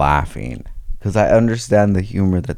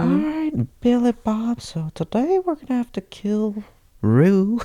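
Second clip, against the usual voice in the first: high, put-on sing-song voice; mock villain voice. Friend voicing the woman's scheming thoughts in a high mock voice. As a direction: voice the killer planning it, pitched way up.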